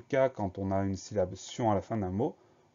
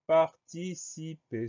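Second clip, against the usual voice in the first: slow and higher, syllables held apart; syllable-by-syllable dictation; 6 semitones higher, much slower than usual. Shown as a demonstration. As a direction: dictate "participer" slowly as par, ti, ci, per. Dictée d'un mot par syllabes. (dictée lente, syllabes bien séparées)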